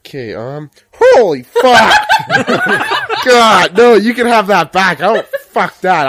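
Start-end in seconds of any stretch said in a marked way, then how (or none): 0.9-2.1 sudden loud high shriek of shock; shocked yell. Real, not acted. Startled by a killer clown picture. 2.1-3.2 high shrill burst of laughter; shrieking laugh. Real, not acted. Reacts to clown picture. 3.2-6.1 loud disgust. Real, not acted. Recoils from clown picture.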